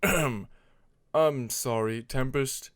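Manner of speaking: lightly voiced gruff dragon, slow; a hint of a doubtful dragon; Alligator voice. A narrator's reading.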